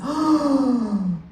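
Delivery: sharp high delighted gasp. Retold or imitated. Comic reliving his first business upgrade, with a sharp awed gasp. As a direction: gasp in awe.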